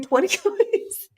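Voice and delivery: words break into a high laugh; giggling at how fast the trolls get buried. Real, not acted. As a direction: laugh through the number, delighted. Laughing at the pile of comments.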